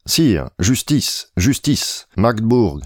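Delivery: high, slow tragic plea; grand tragic stage delivery. Acted. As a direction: plead like a tragic heroine. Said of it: "Elle me reçut sur un ton tragique, comme Chimène".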